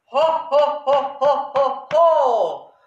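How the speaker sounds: loud, rhythmic mock ho-ho laugh; hammed-up storybook laughing; acted laughter. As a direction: boom out the snails' laugh beat by beat. Acts the snails' laughter again.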